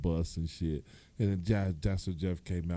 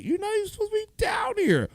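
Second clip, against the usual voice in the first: scolding manager; 6 dB louder, faster than usual. Retold or imitated. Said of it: "He was like".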